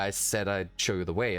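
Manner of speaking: ordinary talk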